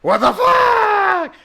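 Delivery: high, slow, drawn-out yell; shocked yell. Retold or imitated. Player reacting to snakes falling from ceiling, slow shocked yell. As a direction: stretch it out in disbelief. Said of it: "You're like".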